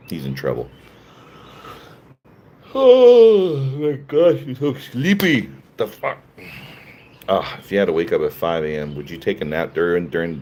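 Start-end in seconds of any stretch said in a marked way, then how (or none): 2.7-6.2 high, loud, slow exclamation; drawn-out amazed cry; surprised. Real, not acted. Reacting to sleepy streamer.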